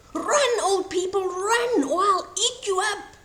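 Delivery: storybook wolf howling a threat; howled threat. A narrator's reading. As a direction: howl it out to scare them. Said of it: "he howled".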